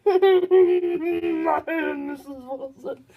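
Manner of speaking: slow, put-on stranger voice; put-on lady voice. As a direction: introduce yourself as someone else, slowly. Unexplained stranger persona introduces herself.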